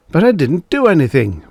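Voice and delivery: high, fast protest. Acted. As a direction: insist on innocence, rushing. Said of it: "protested Engel".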